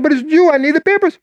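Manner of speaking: pressing, high-pitched demand; mock-urgent boss voice; insistent boss. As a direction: push him for the papers, urgent and high.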